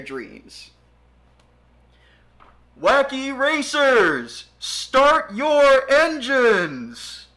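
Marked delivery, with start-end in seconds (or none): 2.8-7.3 slow, booming race announcer call. Acted. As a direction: stretch it out like a stadium announcer.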